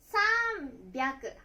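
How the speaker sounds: 三百 read "san-byaku", voiced b; changed reading sanbyaku. Shown as a demonstration. Teacher showing where the sound changes, san-byaku. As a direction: stretch 三, then say 百 as "byaku". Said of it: "発音が変わります".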